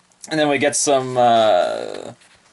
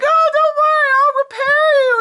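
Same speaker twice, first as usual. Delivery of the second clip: very high, loud chirpy voice; Akashi's voice; 7 dB louder than usual. Acted. Akashi offering repairs to Musashi, very high chirp. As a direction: chirp it cheerfully. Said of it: "And Akashi's like".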